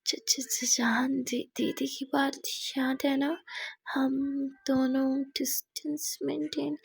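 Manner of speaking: stammering, breathy whisper; breathless whisper. A narrator's reading. Muskan, flustered after the kiss, stammering breathless whisper. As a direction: catch your breath between broken words. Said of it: "मुस्कान की सांस एकदम तेज़ हो जाती है".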